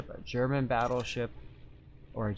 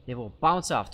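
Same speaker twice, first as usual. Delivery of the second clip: ordinary talk, nothing marked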